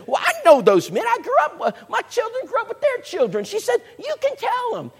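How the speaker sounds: very high, sing-song lady; high woman's voice. Retold or imitated. Sheila tells the preacher she knows those men, high-voiced. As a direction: float way up into a bright high voice.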